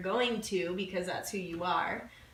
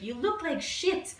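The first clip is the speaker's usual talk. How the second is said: French friend; 8 semitones higher, 4 dB louder than usual. Retold or imitated. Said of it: "She's this French woman".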